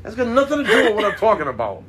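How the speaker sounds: high-pitched disbelief; shocked what-the-hell outburst; incredulous. Real, not acted. Outraged at off-topic remark.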